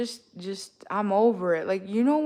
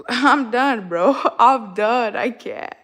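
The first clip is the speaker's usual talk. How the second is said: louder, laughing I'm done; 7 dB louder, slower than usual. Real, not acted. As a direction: laugh through the exasperation. Laughing at own rant.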